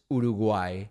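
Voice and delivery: Uruguay said oo-doo-GWAI; Uruguay ending on a Spanish GWAI; Spanish pronunciation. Shown as a demonstration. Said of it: "reminder of the way that it's pronounced".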